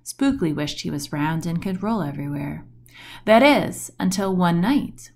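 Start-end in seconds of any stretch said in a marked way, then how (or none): none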